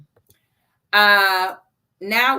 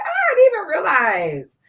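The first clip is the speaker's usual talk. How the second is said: surprised; 9 semitones higher, 5 dB louder than usual. Retold or imitated. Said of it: "I'm over here like".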